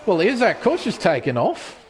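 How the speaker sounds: usual speaking voice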